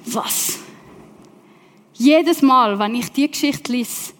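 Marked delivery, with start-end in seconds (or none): 0.1-0.6 breathless, whispered disbelief; shocked whisper. Real, not acted. Preacher to congregation, stunned whispered what. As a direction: gasp it out under your breath. Shocked reaction to God's demand.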